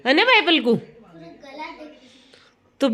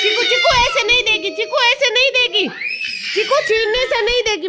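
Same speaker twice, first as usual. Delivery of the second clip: much higher, laughing as she calls the name; high laughing plea; 9 semitones higher than usual. Real, not acted. Mother laughing while telling her son off about the remote.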